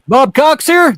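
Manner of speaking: very high, loud voice; joke customer. Acted. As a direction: squeak it out high.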